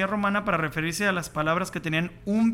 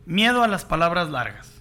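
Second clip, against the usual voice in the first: ordinary talk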